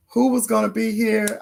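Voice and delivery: ordinary talk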